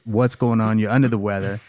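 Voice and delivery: ordinary delivery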